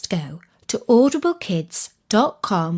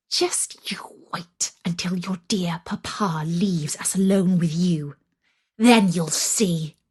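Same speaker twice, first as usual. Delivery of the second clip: spiteful whisper; 3 semitones lower than usual. A narrator's reading. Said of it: "one of the sisters would whisper in Vasilisa's ear".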